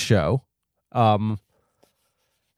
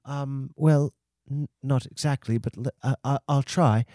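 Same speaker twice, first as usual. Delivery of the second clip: put-on British accent. [name] tells the host he can't do it, hesitant British accent. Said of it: "I have this British accent".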